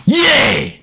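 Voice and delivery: high, loud celebration shout; victory yell. Real, not acted. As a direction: let it rip after the shot. Celebrating a green shot.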